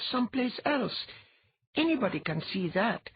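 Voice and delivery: put-on high boyish voice, matter-of-fact; an offhand young boy explaining; boy Geher. A narrator's reading. Geher explaining Latha's gift to Ilya, boyish voice.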